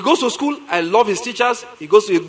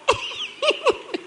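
high burst of laughter; 6 dB quieter than usual. Real, not acted. Laughing at story.

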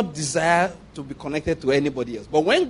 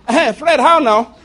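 high, loud friendly hail; mock hearty call; 8 semitones higher, 8 dB louder, slower than usual. Greeting the vice chancellor loudly.

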